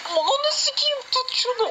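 real high desperate screech; frantic shriek. Real, not acted. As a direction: scream it, desperate for the win. Begging the slot to hold.

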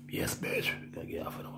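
hushed unvoiced whisper. Conspiratorial aside to listeners, whispered. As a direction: breathe it like a secret aside.

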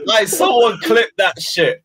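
laughing shout. Real, not acted. Howling at the blunder.

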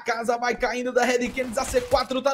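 excited, driving esports call; live play-by-play. Real, not acted. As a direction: call the kills with rising punch, stretching key words. Narra lance de e-sports ao vivo. (narração empolgada de e-sports)

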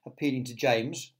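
ordinary delivery

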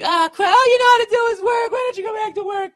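shrill screaming wife. Pretend. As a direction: shriek it like a furious nagging wife. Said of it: "if she said it like that".

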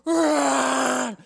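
loud, high blaring "wah"; long honking yell; truck horn imitation. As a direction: blast it like a monster truck horn. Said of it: "Monster horn".

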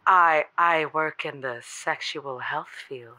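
low and slow, sultry. Shown as a demonstration. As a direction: say it low and slow to sound hot. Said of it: "Say that again".

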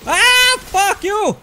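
sudden high, loud curse at the boss; furious yell. Real, not acted. Gamer hit by a boss, yelling a furious curse.

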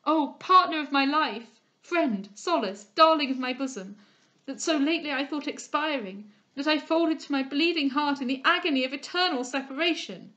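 enraptured lover crying over his beloved; frantic rapture. A narrator's reading. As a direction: hold her to a bleeding heart in wild joy. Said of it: "his frantic rapture".

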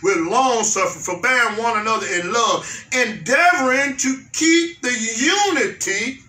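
drawn out, each word weighed; unhurried verse read aloud; slow scripture reading. Shown as a demonstration. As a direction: read it slowly and deliberately.

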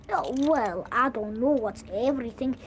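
ordinary delivery

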